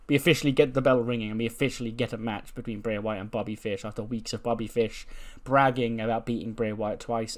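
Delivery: ordinary delivery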